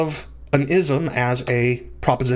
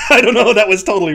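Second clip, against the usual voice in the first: embarrassed laugh through the words; 5 semitones higher, 7 dB louder, much faster than usual. Real, not acted. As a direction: burst out laughing, louder and higher. Cracks up at own French attempt.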